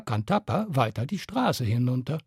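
plain reading voice

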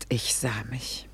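barely voiced, falling away; soft whisper. A narrator's reading. Woman admitting she saw herself, whispered. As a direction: let it slip out as a quiet admission.

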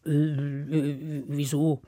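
low, soft, slack Hans voice; dopey grumble. Acted. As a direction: mumble like a lazy lad. Lazy Hans answers mother.

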